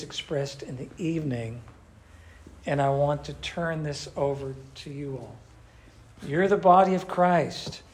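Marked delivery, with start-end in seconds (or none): none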